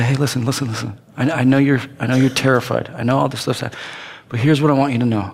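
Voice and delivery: soft, low comfort; tender reassurance. Retold or imitated. Jesus speaking to people in their storms, tenderly. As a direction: speak to the frightened gently.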